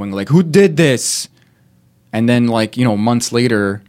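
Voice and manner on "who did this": loud, higher accusing yell; mock blaming shout. Bystanders pointing fingers after a mess, loud mock accusation.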